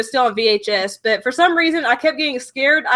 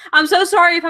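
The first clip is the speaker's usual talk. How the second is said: normal talking voice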